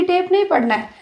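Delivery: ordinary delivery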